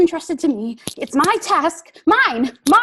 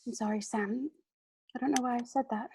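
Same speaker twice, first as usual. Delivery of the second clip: high and much softer, sorry; contrite apology; 5 semitones higher, 10 dB quieter, faster than usual. Acted. Frodo apologising to Sam, contrite. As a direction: take it back gently.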